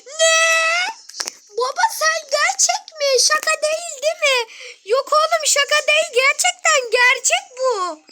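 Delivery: high, loud, amazed voices; thrilled shouting; excited disbelief. Real, not acted. Family shouting over the good news.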